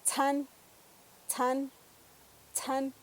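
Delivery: tsan with a puff of air after the ts; aspirated ts. Shown as a demonstration. Teacher giving a Purépecha word with aspirated ts. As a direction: breathe out on the ts of tsan. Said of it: "palabras como".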